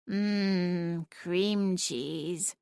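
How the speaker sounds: soft, dreamy longing. A narrator's reading. Cat daydreaming about cream cheese, dreamy and soft. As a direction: savour the words as if tasting them. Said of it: "her tone dreamy".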